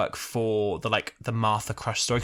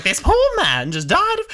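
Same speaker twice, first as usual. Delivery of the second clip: high, loud, slow swooping imitation; exaggerated cadence; 14 semitones higher, 7 dB louder than usual. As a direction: swoop up and down through the line. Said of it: "She goes up and down".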